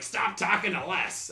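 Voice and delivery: slow, scolding teacher mom; a hint of a stern mother snapping. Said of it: "that your impression of your mom?".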